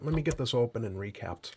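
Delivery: ordinary talk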